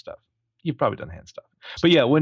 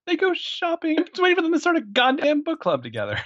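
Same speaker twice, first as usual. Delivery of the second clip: much higher, incredulous echo; mock disbelief. Real, not acted. Mocking the movie's plot to his co-host, high and incredulous. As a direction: squeak it out in disbelief.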